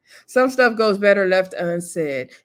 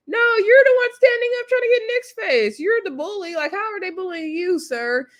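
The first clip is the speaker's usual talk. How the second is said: indignant outburst; 12 semitones higher than usual. Real, not acted. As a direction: call him out in disbelief. Speaker scolds Carlos directly.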